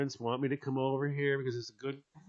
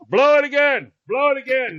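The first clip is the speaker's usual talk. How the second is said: loud, higher angry yell; loud furious command repeated; retold shout; 8 semitones higher, 12 dB louder than usual. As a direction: bellow the order twice. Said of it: "all I heard was".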